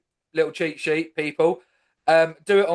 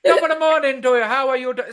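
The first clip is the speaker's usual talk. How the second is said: Irish accent demo that drifts into Indian; Irish accent slipping into Indian; 5 semitones higher, 4 dB louder than usual. Shown as a demonstration. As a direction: try an Irish accent, but Indian creeps in. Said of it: "see, instantly".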